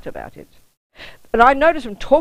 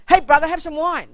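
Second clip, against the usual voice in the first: imitating Janet; 9 semitones higher, 4 dB louder than usual. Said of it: "So Janet said".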